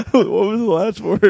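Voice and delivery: high, laughing ask; laughing question. Real, not acted. Host asking through laughter after a misheard title. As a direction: still giggling as you ask. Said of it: "giggling disbelief".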